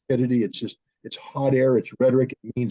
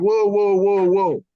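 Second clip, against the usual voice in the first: higher, louder mock calming whoas; mock Biden; 7 semitones higher, 4 dB louder, slower than usual. Biden cutting off the frantic caller, mock alarm. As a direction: hold him back like a jittery boss. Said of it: "And Biden went".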